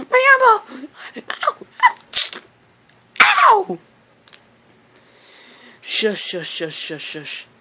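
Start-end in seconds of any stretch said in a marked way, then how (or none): 0.1-3.8 very high, loud yelps of pain; shrill hammed-up ow; cartoon pain cries. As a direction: squeal like you've been jabbed. Being hurt, crying out.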